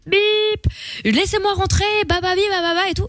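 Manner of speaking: high-pitched mock drunken yell. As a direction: whine it high like a drunk star. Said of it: "a commencé à hurler son nom".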